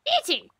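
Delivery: high falsetto chirp, falling; piping two-note mock birdcall; bird call. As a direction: imitate a little bird.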